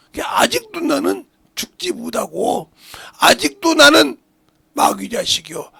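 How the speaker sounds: loud, high-pitched preacher's cry; impassioned sermon, punched phrases; full-force preaching. Real, not acted. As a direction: drive each phrase home to the congregation.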